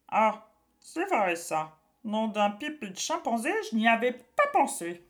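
a witch caught out. A narrator's reading. Witch realizing problem.

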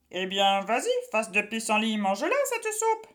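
put-on a witch ordering the boy. A narrator's reading. Witch commanding Pierre.

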